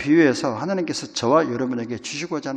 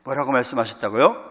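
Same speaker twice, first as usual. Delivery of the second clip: ordinary delivery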